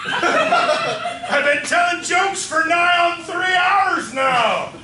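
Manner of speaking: slow loud pirate boast; pirate captain. Acted. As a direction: drag it out like a captain.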